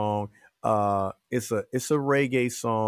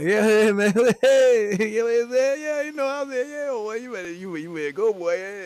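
put-on much higher, loud, sloppy barroom drunk voice; loud, high-pitched drunk bar talk with laughs; drunk guy; 10 semitones higher, 4 dB louder than usual. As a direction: play a drunk buddy hyping someone at the bar. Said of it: "talking to the drunk guys like I was drunk".

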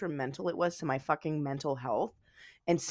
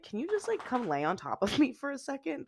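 high, small pleading voice; retold small plea; 7 semitones higher than usual. Retold plea while upset, before crying.

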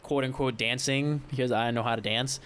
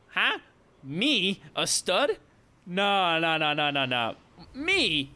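high-pitched incredulous questions, tumbling denials; mock disbelief; 5 semitones higher, much slower than usual. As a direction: act totally astonished at the compliment. Retold disbelief at being called stud.

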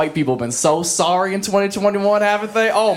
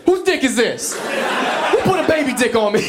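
high, stunned mock panic at the sight; shocked disbelief; 7 semitones higher than usual. Man just out of cold pool, mock horrified in a high voice. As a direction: squeal it like you can't believe your eyes. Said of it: "You're like".

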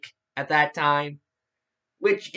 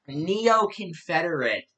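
ordinary talk

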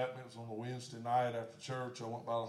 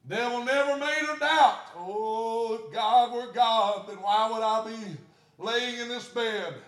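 loud, high preaching; whooping sermon cry; full-force preaching; 10 semitones higher, 13 dB louder, slower than usual. Acted. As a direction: preach it with fire.

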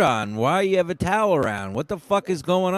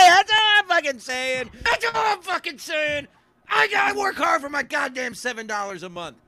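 mock rant; 10 semitones higher than usual. Ranting about paying subscribers.

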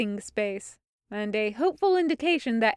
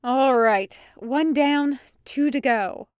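cheerful; 3 semitones higher, 5 dB louder than usual. Acted. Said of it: "Hawk said".

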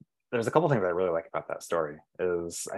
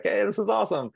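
pitched way up, bright pretend greeting; mock cheerful greeting; 12 semitones higher than usual. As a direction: play a jokey future self, much higher. Imagines his older self.

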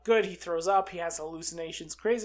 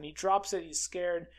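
usual speaking voice

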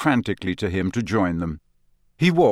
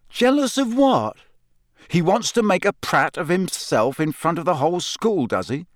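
indignant disbelief; 8 semitones higher than usual. Acted. Harry indignant.

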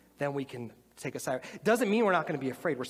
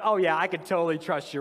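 mock breezy confidence; 7 dB louder than usual. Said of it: "You'd be like".

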